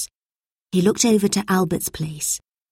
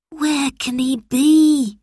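put-on high, slow wondering child's voice; drawn-out, fretful question; worried; 8 semitones higher, much slower than usual. A narrator's reading. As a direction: wonder anxiously where he is. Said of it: "He was very worried".

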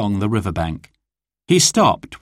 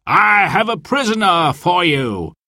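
higher, carrying call to a rider; sheriff's call; 6 semitones higher than usual. Acted. Sheriff hails Sir Guy about the prisoner, a carrying call. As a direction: announce it across the clearing. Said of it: "cried the sheriff".

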